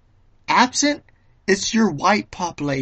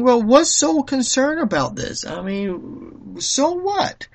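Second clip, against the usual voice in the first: mock skeptical voice, pitched higher, shrugging it off; dismissive listener; 7 semitones higher than usual. Imagined listener brushing off the concern, dismissive. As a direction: play a doubter who asks so what. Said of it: "may be saying".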